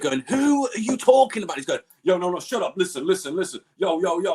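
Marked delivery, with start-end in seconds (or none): 0.2-1.6 high, strained mock frustration; high-pitched incredulous demand; exasperated retold question. 2.0-4.3 higher-pitched mock brush-off; lightly acted pushy storyteller; imitated interrupting talker.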